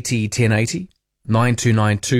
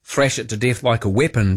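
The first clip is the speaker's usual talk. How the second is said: ordinary talk, nothing marked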